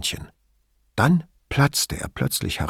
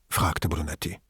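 ordinary delivery, nothing marked

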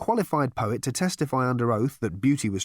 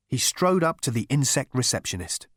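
plain reading voice

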